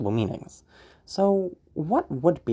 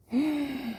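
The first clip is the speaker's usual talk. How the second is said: high, soft character giggle; feminine villain laugh; 14 semitones higher, 5 dB quieter than usual. Shown as a demonstration. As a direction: let out a high villainous titter. Villain character laughs after the reveal.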